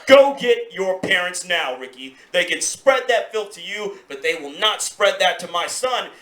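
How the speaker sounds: ordinary talk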